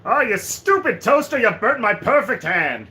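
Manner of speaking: loud, high angry villain voice; Perfect Cell. Acted. Streamer as Perfect Cell cursing a toaster, angry character voice. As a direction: rage at the appliance like an outraged cartoon villain.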